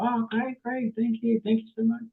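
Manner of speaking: high, soft imitated thanks; grateful lady customer. Lady customer thanking plumber for the valve, imitated grateful voice. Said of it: "And she's like".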